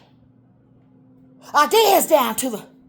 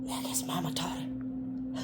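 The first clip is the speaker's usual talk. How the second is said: hushed, breathy whisper; 10 semitones lower, 16 dB quieter than usual. Real, not acted. Whispering about her daddy's mama. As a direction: drop to a whisper.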